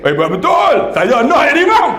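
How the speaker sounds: high-pitched acted eagerness; eager excitement. Listener hearing the imam's salary, excitedly volunteering. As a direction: jump at the offer, pitch shooting up.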